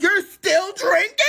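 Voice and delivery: high, loud "still drinking?"; disbelieving shriek at the boyfriend; shocked disbelief. Retold or imitated. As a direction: squeal it in disbelief. Shocked retort.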